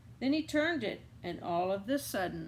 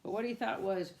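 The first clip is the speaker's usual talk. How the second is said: plain reading voice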